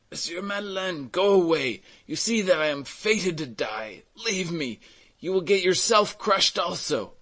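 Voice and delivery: high, strained plea; desperate pleading. A narrator's reading. Old carter fated to die to rescuer, pleading. Trapped man begging rescuer to leave.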